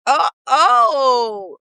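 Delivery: hammed-up startled response; mock surprise. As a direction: imitate a friend taken aback, stretching the oh. Acts out friend's response to poem.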